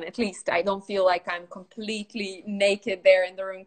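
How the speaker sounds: ordinary delivery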